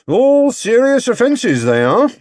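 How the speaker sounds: high, loud, slow official pronouncement; slow, loud officious inspector; stern inspector. Acted. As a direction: lay down the law slowly.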